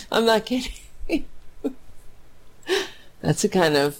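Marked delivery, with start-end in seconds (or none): 0.1-0.7 real choked voice, higher, on the edge of tears; tearing up. Real, not acted. Host moved by viewers' kind chat messages, voice choking up. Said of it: "I'm gonna cry". 0.8-2.2 breathy laugh breaking into a real sob; half laugh, half cry, voice gone; tearful laugh-sob. Real, not acted. Host overcome by viewers' praise, laughing through tears. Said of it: "I'm gonna cry".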